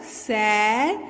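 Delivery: ordinary talk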